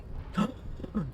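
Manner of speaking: a sharp, quiet mock gasp of offence; hammed-up scandalised gasp; shocked gasp. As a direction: gasp as if the potato were insulted.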